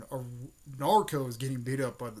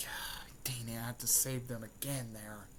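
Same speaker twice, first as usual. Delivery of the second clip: whispered grumble; 8 dB quieter than usual. Retold or imitated. Girl grumbling as she rescues them.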